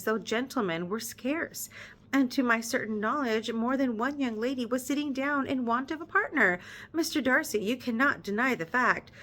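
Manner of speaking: measured read-aloud narration; story narration. Acted. Reader narrating the novel to her viewers, measured storytelling.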